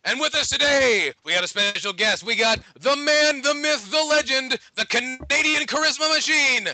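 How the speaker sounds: high, building hype announcer; big ring-announcer introduction; announcer guest intro. Acted.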